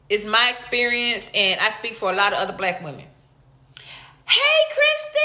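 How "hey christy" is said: high, bright call to a viewer; high squeal of welcome to a viewer; excited greeting. Real, not acted. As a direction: sing out a name to a fan. Greeting live viewer by name.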